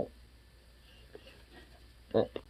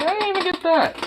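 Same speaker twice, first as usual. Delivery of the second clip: much higher startled exclamation; surprised squeak. Real, not acted. Owner startled when the dog grabs something. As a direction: caught off guard, voice jumps up.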